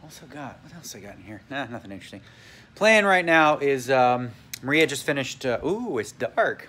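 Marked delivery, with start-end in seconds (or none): none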